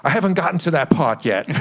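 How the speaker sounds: acting out a touchy reply; defensive. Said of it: "I immediately got defensive".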